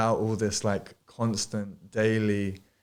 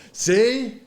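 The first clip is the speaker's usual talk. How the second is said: triumphant gloating; 10 semitones higher, 4 dB louder than usual. Retold or imitated. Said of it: "I was like".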